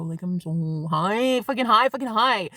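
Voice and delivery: stretched out, dreamy and baked; slow dazed drawl; slow stoned drawl. Retold or imitated. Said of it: "I'm like".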